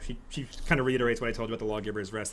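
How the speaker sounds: ordinary talk, nothing marked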